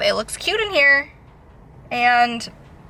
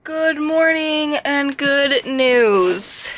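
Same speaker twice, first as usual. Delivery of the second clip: slow, bright and louder; cheerful greeting; 4 semitones higher, 4 dB louder, much slower than usual. Real, not acted. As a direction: announce it like happy news. Cheerful morning greeting to camera.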